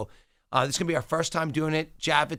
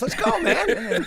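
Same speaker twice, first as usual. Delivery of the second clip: slow, high, booming hype-man call; announcer voice; 6 dB louder, much slower than usual. Acted. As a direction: hype the crowd like a stadium announcer. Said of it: "I'm ready for my announcer voice".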